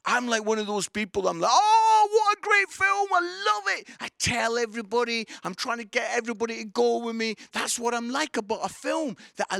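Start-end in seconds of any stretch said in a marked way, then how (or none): 1.5-3.9 high, loud raving; gushing enthusiasm. Acted. Film fan raving to everybody, loud high gushing. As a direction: gush like a fan who can't shut up. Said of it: "I will not shut up about it".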